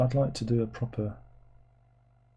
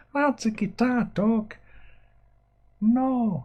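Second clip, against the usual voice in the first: very high, drawn-out silly voice; mock audience voice; 12 semitones higher, much slower than usual. Streamer mimics viewers refusing guitar talk, silly high voice. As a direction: squeal it slowly in a put-on voice.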